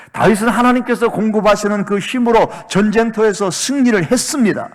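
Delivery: high, loud, driving sermon delivery; impassioned pulpit shout; full-force preaching. Acted. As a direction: preach it out at full volume, pressing each phrase.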